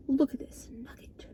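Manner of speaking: soft slow whisper. Real, not acted. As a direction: hush it over the nugget. Whispered over food.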